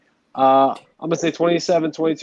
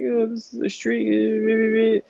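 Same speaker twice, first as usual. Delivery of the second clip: high, drawn-out mocking fan voice; stretched, high-pitched put-on whine; mock Ravens fans; 10 semitones higher, much slower than usual. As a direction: mimic obnoxious fans, high and slow. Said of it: "Ravens fans who are like".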